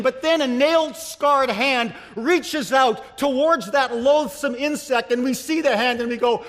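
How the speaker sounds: high, loud, dramatic sermon storytelling; full-force preaching. Real, not acted. Preacher telling of the scarred hand reaching out, impassioned. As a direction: drive toward the rescue with fervor.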